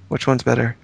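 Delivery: usual speaking voice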